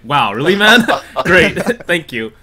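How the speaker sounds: loud, high, laughing amazement; retold wowed reaction, broken by laughs; mock amazement. Player retelling his reaction to pages of notes, mock amazement.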